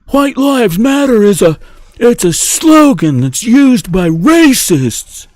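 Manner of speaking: high, put-on scolding voice; mock outrage. Mimicking an outraged objector.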